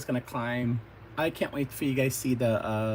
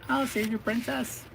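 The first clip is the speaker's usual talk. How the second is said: high-pitched hero voice; heroic promise; 10 semitones higher than usual. Acted. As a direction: play the boy hero coming to the rescue. Hero announces the rescue.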